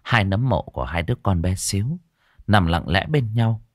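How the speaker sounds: plain reading voice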